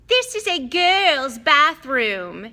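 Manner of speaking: put-on high, slow, sulky ghost girl; drawn-out wail of a prim ghost; ghost girl Myrtle. Ghost girl scolds boys in her bathroom, slow and high.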